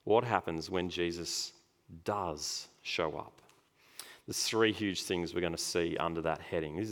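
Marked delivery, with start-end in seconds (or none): 0.0-3.4 does drawn out slowly and stressed; slow build to does. Shown as a demonstration. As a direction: lean hard on does, slowly. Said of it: "second amazing thing".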